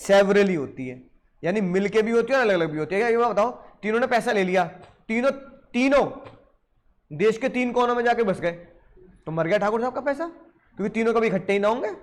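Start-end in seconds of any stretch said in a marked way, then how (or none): none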